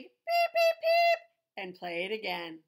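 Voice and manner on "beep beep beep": very high, loud little beeps; squeaky duckling peeps. Acted. Reading to a child, duckling peeping squeakily. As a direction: peep like a tiny duckling.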